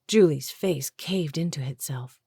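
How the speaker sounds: usual speaking voice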